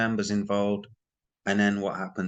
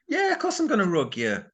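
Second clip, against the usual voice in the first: much higher mock admission, said with glee; mock confession; 12 semitones higher than usual. Vlogger mocking a scammer who would admit it, in a much higher mock voice. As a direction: play the crook cheerfully owning up. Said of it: "They're not gonna come out and say".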